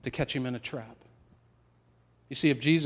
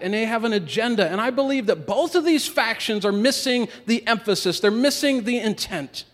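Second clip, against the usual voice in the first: preaching at full force; 5 semitones higher, 5 dB louder than usual. Acted. Preacher presses on the factions.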